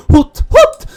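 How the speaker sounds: high loud owl hoot. Acted. Player voicing the owl character, high hooting.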